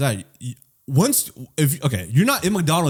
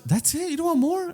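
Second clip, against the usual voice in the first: higher, softer coaxing; mock worker; 3 dB quieter than usual. Drive-thru worker tempting a customer into more. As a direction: coax him to order more. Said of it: "I'll be getting so much".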